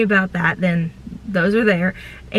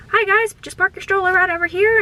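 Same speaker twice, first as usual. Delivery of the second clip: cheerful cast member; 12 semitones higher than usual. Retold or imitated. Greeting guests at stroller parking.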